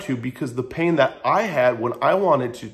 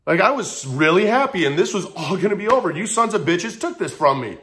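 mad outburst retold, pitched up, drawing out the first words; higher-pitched, indignant complaint at everyone; retold anger; 7 semitones higher than usual. Man recalling being mad at people for spoiling his plan, retold indignant anger. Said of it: "I'm mad now at people".

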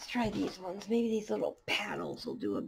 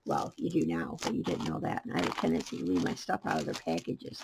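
normal talking voice

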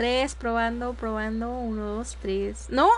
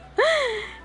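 laughing; 10 semitones higher than usual. Real, not acted. Relieved chat hears her.

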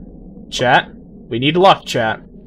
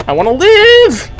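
I wanna live cried out much higher; desperate high plea; 12 semitones higher than usual. Real, not acted. Streamer begging the game to survive, panicked high squeal. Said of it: "I don't wanna die!".

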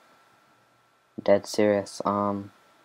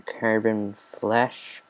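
ordinary talk

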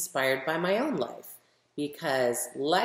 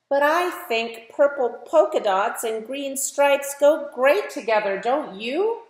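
put-on lively, louder storybook reading for kids; reading to children; 4 semitones higher, 7 dB louder than usual. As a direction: read it like a picture book for a class, brightly. Reads picture book aloud to children.

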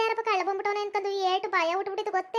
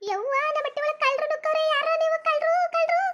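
high, panicked cries of thieves; crying out for help; 5 semitones higher than usual. Acted. Woman in her yard at night crying out high for help against thieves. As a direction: scream for help, thieves in the house.

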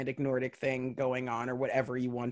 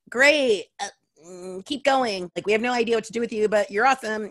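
higher and louder, fast and gushing; mock eager enthusiasm; 7 semitones higher, 5 dB louder than usual. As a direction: gush like a clueless fan. Industry people reacting to her.

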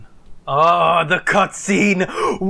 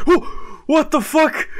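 high startled cry into laughter; shocked laugh; 9 semitones higher, slower than usual. Real, not acted. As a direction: react to the gore with disbelief. Shocked at gore.

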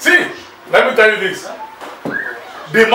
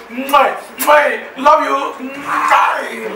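smacking air kisses, higher; 5 semitones higher, much slower than usual. Acted. Blowing kisses down the phone.

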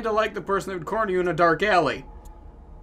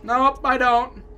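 protesting; 6 semitones higher, 7 dB louder, much slower than usual. Acted. Player answers character's letter in protest.

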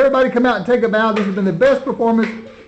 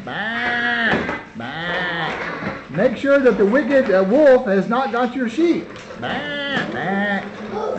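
sheep bleat; 4 dB quieter, much slower than usual. Pretend.

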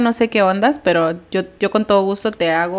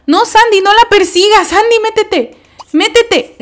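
high, loud scolding calls; frantic calling out; 10 semitones higher, 7 dB louder than usual. Real, not acted. Player yells at Sandy the dog to go inside. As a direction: yell at the dog to get back inside.

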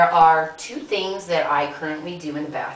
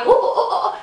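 mock straining grunts; 6 dB louder than usual. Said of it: "I don't want it going".

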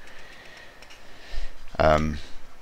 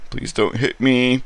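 high, louder pleading victim; mock pleading; 9 semitones higher, 4 dB louder than usual. Victim begging the intruder not to hit him, mock pleading. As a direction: beg not to be hit, half-acted.

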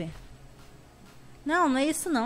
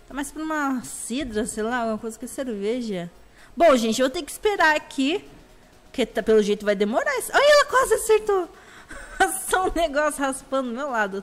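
ordinary talk, nothing marked

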